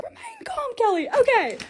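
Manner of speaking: high, small squeak of self-control; barely contained excitement. Real, not acted. Creator telling herself to calm down over gifts, high contained squeal.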